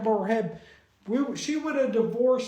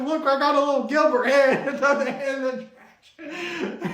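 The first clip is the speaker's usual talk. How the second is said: laughing; 3 semitones higher, 5 dB louder than usual. Real, not acted. Laughing at the Gilbert head.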